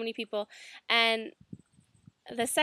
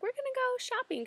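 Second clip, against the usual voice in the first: much higher, eager sing-song; excited quote; 14 semitones higher, 3 dB quieter than usual. Retold or imitated. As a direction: squeal the plan like an excited girl. Excited friends planning shopping trip.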